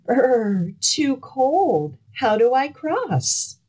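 shivering gingerbread man. Acted. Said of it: "he stopped and shivered".

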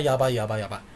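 normal talking voice

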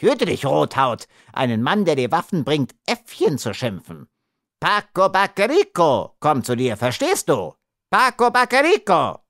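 high, indignant scolding; puffed-up little man taking offence; angry warning. A narrator's reading. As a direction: scold in offended fury, rising to a cry. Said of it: "rief der Kleine zornig".

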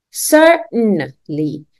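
"Certainly" very slowly, part by part, with a muted T; slow syllable breakdown. Shown as a demonstration. Teacher modelling for a learner, slow muted-T "Certainly". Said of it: "pronunciation which is like mute".

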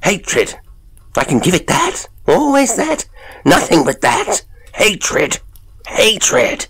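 high, vehement outburst; fierce cry. A narrator's reading. Tormented man offers the thing only hatred, crying fiercely. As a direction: spit it out in high-pitched fury. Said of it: "Gilday cried fiercely".

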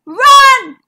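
high, loud urgent yell; shout. A narrator's reading. Zigbee warns Zara of the crocodile, shouting. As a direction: yell the alarm at full voice. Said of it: "shouted Zigbee".

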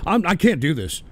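ordinary talk, nothing marked

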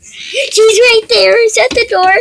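loud excited pointing out. Pretend. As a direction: call it out with excitement. Excited spotting the dog.

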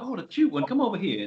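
high cooing grown-up voice; mock-sweet adult calling a child over. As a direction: coo at the cute little singer. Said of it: "It was like".

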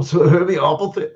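put-on a jokey, half-hearted German accent on homeopathy; bad German accent. Streamer reading a German-spelt word to chat in a mock German accent. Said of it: "sorry for my bad German accent".

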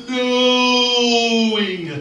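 long, high, loud drawn-out "no"; Darth Vader wail. Acted. Robed monk swooping at Jack, melodramatic wail. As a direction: hold the "no" as a melodramatic cry. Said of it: "voice Darth Vader".

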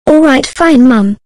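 loud, high, defiant shout at Mom; raising her tone. Acted. Grounded daughter yelling back at her mom, furious. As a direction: snap back at full volume in angry defiance. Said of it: "don't you dare to raise your tone at me".

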